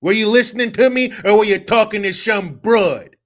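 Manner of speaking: put-on loud, high, indignant New York accent; brash put-on New York accent, full volume. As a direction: tell him off like a fired-up New Yorker. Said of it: "give us your New York accent".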